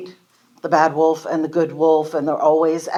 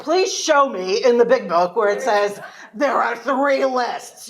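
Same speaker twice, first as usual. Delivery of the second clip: challenging sponsor; 7 semitones higher than usual. Retold or imitated. Sponsor challenges her made-up lists.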